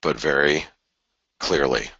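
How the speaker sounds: deliberate, with long pauses between phrases; slow and clear. Real, not acted. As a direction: spell it out slowly, leaving gaps. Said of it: "let me say this really slowly".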